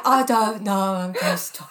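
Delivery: put-on loud, botched English accent through laughter; hammed-up bad English accent, cracking up; failed English accent. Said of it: "I couldn't do an English accent".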